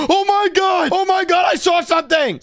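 high-pitched startled outburst; screaming in alarm. Acted. Ghost hunter glimpsing something outside, high startled cries. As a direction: yelp at a sudden sighting. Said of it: "what's with all the screaming in here".

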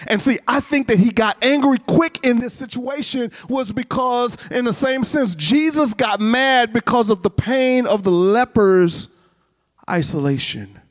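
high, driving sermon delivery; impassioned preaching. Real, not acted. Preacher explaining the leper story to the congregation, impassioned. Preacher at raised pitch and force.

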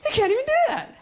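very high, squeaky protest; hammed-up nervous kid bluffing; flustered kid. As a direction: babble it in a strained high voice. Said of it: "I go".

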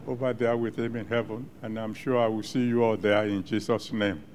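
usual speaking voice

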